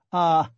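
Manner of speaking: mocking "ah" into a breathy laugh; acted laugh. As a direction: break into a short acted chuckle. Porfiri laughs at his own speech.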